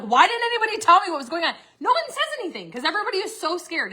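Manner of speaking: high, fast retold exasperation; agitated outburst. Reacting to finding out others flew in, agitated. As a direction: rush it out high, reenacting the shock. Acts out learning others had flown in.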